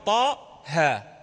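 loud open "طاء، ها" said as "taa, haa"; both "طاء" and "ها" without a glottal stop; hamza dropped. Shown as a demonstration. Teacher demonstrating the two letters, hamza dropped. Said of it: "حذفت الهمزة لأن الهمزة ثقيلة".

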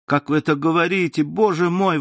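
offended exclamation. A narrator's reading. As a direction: exclaim it, stung by his coldness. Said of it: "воскликнула оскорблённая Валентина".

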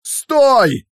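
high, loud cry to stop; shout. A narrator's reading. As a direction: yell it out in alarm. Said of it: "закричал Иван".

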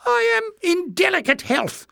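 very high, squeaky whimper; high-pitched, pitiful excuse. A narrator's reading. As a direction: whimper self-pityingly, far up in pitch. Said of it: "Jinx whimpered".

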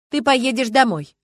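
firmly. A narrator's reading. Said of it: "решительно сказала Люба".

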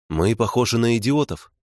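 plain reading voice

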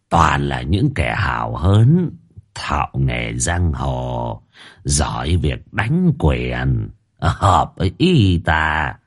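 put-on low, slow slurred drawl of a drunk official; drunken drawl. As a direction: slur it like he's still drunk. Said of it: "dở cái giọng lè nhè, cứ như là người chưa tỉnh cơn rượu".

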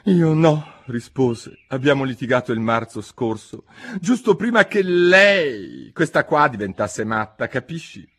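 a grumbling, sorrowful Hatter; sad Hatter. Acted. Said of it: "scosse tristemente il capo".

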